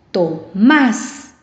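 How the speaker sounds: "Tomás" with MÁS emphasized; stress on last syllable. Shown as a demonstration. Teacher giving an example ending in S, "Tomás" stressed on MÁS. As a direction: separate TO, then punch MÁS in "Tomás". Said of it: "lleva la fuerza de voz en la última sílaba".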